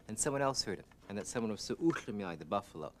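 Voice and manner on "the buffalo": plain reading voice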